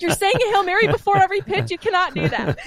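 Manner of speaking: a coach who can't believe what he just heard; incredulous dad. Retold or imitated. Dad stunned by Hail Mary.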